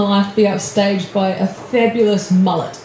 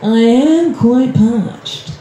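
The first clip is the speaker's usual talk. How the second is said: slow, stretched-out reconsidering; mock-reluctant inner voice giving in; easily tempted brain; much slower than usual. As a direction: talk yourself into it, lingering on each word. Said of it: "But your brain goes".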